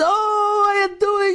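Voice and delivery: high, bright burst of joy at a friend's call; light up, way up in pitch, like an old friend rang; delighted greeting. Retold or imitated. Yaakov greeting Yehuda on the phone, delighted and high-pitched. Yaakov hears from his rescuer.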